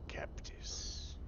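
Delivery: whispered Captus, long hissing s; drawn-out s like a snake. Shown as a demonstration. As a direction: Captus with its s stretched out. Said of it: "Do you have to really draw out the S sound?".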